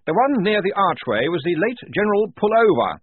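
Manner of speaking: high, loud officer's voice; Blouse. A narrator's reading. Lieutenant identifying a dead general, high keen voice. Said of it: "said Blouse".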